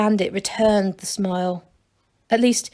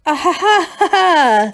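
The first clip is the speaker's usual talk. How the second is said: high, forced nervous laughter; 8 semitones higher, 4 dB louder than usual. A narrator's reading. Bandit chief laughing nervously at Masklin, high and forced. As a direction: laugh it off in a panic. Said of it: "said the chief nervously".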